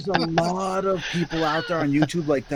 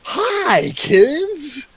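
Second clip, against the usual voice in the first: much higher, louder sugary greeting; mock kiddie host; 10 semitones higher, 6 dB louder than usual. Contrasting Cousteau with a children's host, high, loud, sugary greeting. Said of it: "his demeanor wasn't".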